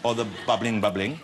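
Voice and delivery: "bubbling, bubbling" in a playful put-on street voice; mock street accent. Host riffs in slang, mock street accent. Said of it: "What is his accent?".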